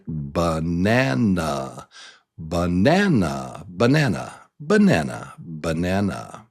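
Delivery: banana with a rise on NAN; teacher's repeated banana, middle syllable stressed. Shown as a demonstration. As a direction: swell on the NAN of banana, then fall. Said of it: "You hear it?".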